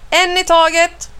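high, carrying instructor's call. A narrator's reading. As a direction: keep the children in order. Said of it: "ropar Karim".

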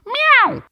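a high, loud meow; cat meow. Acted. The cat meows while puzzling over the riddle.

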